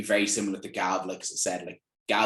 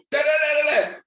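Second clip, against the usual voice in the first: high, loud babbling da-da-da; gibberish Gav impression; 8 dB louder than usual. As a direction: mimic Gav as unintelligible fast talk. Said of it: "Gav would go".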